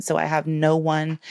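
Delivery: ordinary talk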